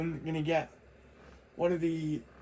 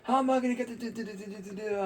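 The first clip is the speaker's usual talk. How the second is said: higher-pitched pestering, trailing into stammer; annoyed; 4 semitones higher, faster than usual. Retold or imitated. Pestering stepdad about getting the phone, annoyed. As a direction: imitate your own nagging, tailing off in repeated syllables. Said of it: "and I got annoying".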